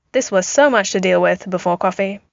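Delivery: ordinary delivery, nothing marked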